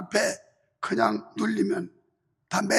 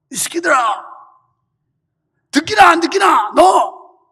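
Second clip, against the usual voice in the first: gym teacher yelling in Jeolla dialect; loud, high-pitched Jeolla-dialect scolding; 6 semitones higher, 16 dB louder than usual. Retold or imitated. Gym teacher scolding middle schoolers, shouted Jeolla dialect. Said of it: "전라도 사투리로".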